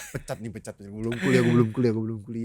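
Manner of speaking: low, muttered mock panic; softly chanted, pretend dread; inner panic. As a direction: repeat it under your breath as a worried inner voice. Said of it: "di otak gue kayak".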